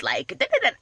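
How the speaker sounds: high, silly blah-blah babble; mocking da da da. Mocking the imagined rant.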